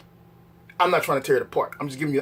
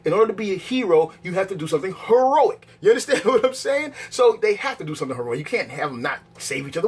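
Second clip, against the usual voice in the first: ordinary talk, nothing marked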